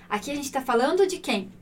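ordinary talk

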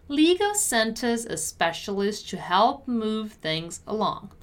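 measured, carefully articulated read; deliberate teacher's model. Shown as a demonstration. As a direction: give each word room for learners. Teacher models sentence slowly first.

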